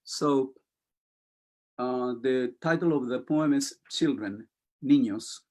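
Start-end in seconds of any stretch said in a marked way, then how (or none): none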